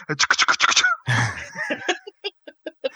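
choppy mouth helicopter sound; helicopter noise. Shown as a demonstration. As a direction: chop like helicopter blades. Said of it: "I can do a helicopter".

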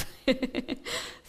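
short breathy laugh at own remark; a quick chuckle; amused laugh. Real, not acted. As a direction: laugh lightly mid-talk.

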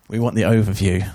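called out loud from the room; calling out. Real, not acted. Listeners calling out to the teacher, loud. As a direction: shout the request up to the speaker.

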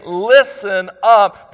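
LISTEN UP drawn out slow and high; emphatic call for attention. Retold or imitated. Preacher voicing Jesus demanding attention, slow and emphatic. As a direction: stretch out "Listen up" as a weighty command. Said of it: "is of paramount importance".

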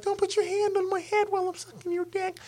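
mock complaining woman. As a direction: mimic her objection in a tiny high voice. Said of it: "bitches that be like".

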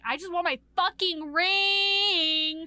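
loud, high, drawn-out mock demand; exasperated demand. Witch wanting her stolen ring back, exasperated loud whine. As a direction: wail it, stretching the last word long.

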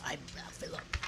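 low whispered goat voice. Retold or imitated. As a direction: breathe it low like a creepy goat. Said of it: "talked like this".

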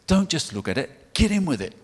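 imitating Steve Irwin's eager call, rising high and loud; pumped and excited. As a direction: throw it out like a keen wildlife host. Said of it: "absolutely pumped and excited".

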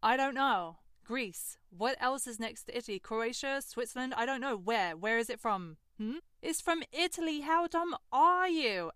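mock-angry retort to the boyfriend; exasperated mockery. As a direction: rattle off guesses in frustration. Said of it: "I'm getting really fucking angry".

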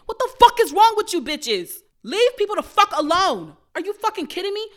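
high, loud outraged yelling; furious shout. Real, not acted. Ranting at strangers who comment on weight, furious shout. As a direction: blast the nosy people with rage.

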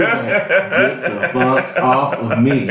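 slow, drawn-out horse voice through a laugh; fed-up horse. Retold or imitated. Voicing the horse he slid off of, slow and laughing. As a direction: drag it out like an annoyed horse.

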